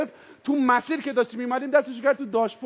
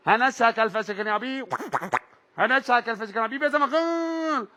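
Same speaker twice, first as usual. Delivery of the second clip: garbled loudspeaker order; police megaphone. Retold or imitated. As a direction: blare it like a muffled police megaphone.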